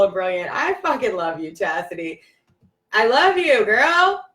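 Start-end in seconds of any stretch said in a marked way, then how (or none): 2.9-4.2 high, loud delighted call; excited shout-out. Real, not acted. To her friend Chastity, high delighted call-out. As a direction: cheer her on.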